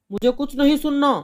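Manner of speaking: a husband furious about the fine; angry father. Acted. Father refuses.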